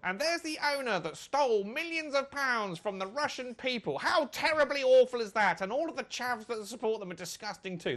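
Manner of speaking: high, loud, hammed-up match commentator; mock football commentator. Host mocking what commentators say, as a hammy commentator. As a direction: ham up the excitement of a TV commentator. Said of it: "they should say".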